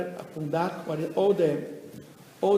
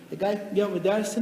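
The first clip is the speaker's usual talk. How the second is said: ordinary talk, nothing marked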